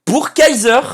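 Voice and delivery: high, loud call-out; loud announcement. Real, not acted. Streamer calling out his bro Kaiser, loud announcement. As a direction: proclaim the winner at full voice. Announces who gets the country.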